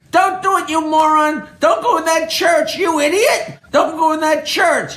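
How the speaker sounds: high-pitched yelling at the TV; mock yelling. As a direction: yell at the screen like an exasperated wife. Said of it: "she's yelling at the T V screen".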